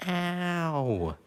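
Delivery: sharp yelp of pain; real ouch as the toe hits; stubbed toe. Real, not acted. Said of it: "Stubbed my toe".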